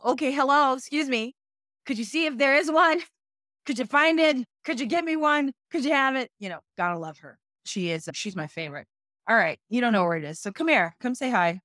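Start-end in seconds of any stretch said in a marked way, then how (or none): 0.0-6.3 higher and louder, over-polite asking again and again; mock-patient plea to a kid who won't listen; exasperated pleading. Mom mock-politely begging her kid to find a water. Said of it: "I have to go".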